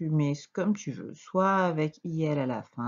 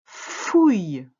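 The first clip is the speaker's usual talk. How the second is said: loud, drawn-out dictated syllable; dictation; 7 dB louder than usual. Shown as a demonstration. Teacher dictating the first syllable to a pupil, loud and drawn out. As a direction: call the syllable out for writing down. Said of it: "Quatrième syllabe".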